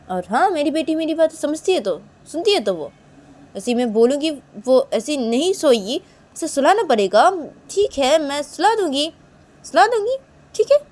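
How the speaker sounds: loud, high-pitched indignant insistence; worked-up retort; defiant insistence. Acted. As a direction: insist stubbornly, voice climbing. Acted defiant retort to Manisha.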